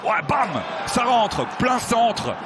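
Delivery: ordinary talk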